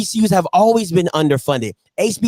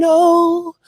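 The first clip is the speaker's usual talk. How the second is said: mocking echo; 9 semitones higher, slower than usual. Mocking the caller's word.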